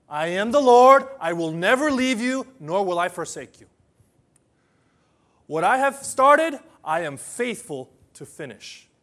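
loud voice of God promising; proclaiming God's words. Acted. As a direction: thunder out the Lord's promise.

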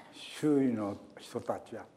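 ordinary talk

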